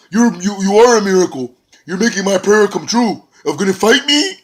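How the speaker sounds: high, loud, gleeful voice; mock glee. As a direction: savour the fight like a gift. EC3 gleeful at getting a fight.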